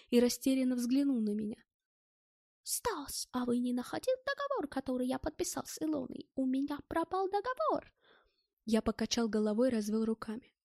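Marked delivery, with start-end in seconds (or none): 2.6-7.9 high, pleading foreigner's question; anxious German character. A narrator's reading. German asks Stas about his lost contract, anxious high voice.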